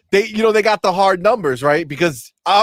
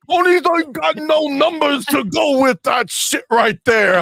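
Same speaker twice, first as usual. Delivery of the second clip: taunting high-pitched yell; mocking shout; 9 semitones higher than usual. Taunting ponies about sales numbers.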